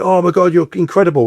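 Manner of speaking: high, over-the-top fake praise; mock gushing. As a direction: gush like a flatterer at a party. Party guests gushing, mocked.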